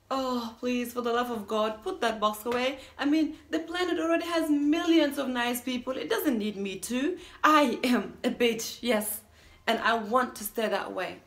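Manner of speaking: loud, emphatic dramatic pleading; exasperated protest. Acted. Refusing to become nice for a partner, exasperated protest. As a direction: argue back forcefully, punching the key words.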